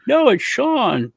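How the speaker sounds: high, slow insisting; imitating cousin. Cousin tells grandpa it is Sean, high slow voice. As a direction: spell it out patiently for grandpa. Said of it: "my cousin was like".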